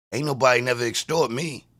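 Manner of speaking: usual speaking voice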